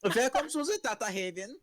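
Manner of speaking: mimicked German accent. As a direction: echo the German greeting. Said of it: "as offensively German as you want".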